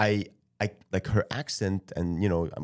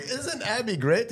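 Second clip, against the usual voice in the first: high slow gushing question; 12 semitones higher, slower than usual. Retold or imitated. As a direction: ask it high and drawn out. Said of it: "I was like".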